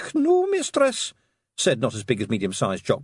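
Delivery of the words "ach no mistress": high, slow nervous Scots pixie; nervous Scottish pixie. A narrator's reading. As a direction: protest nervously like a tiny pixie.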